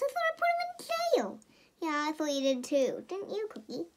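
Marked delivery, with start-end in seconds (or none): 0.0-1.4 high, fast squeaky character; squeaky hero voice. Pretend. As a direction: play the hero in a high rush. Superhero voiced in the comic.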